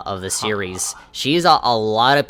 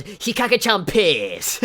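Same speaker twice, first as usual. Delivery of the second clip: high, hammed-up catchphrase, falling at the end; mock over-excited character announcing herself; Hikage; 10 semitones higher, slower than usual. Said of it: "made me laugh out loud".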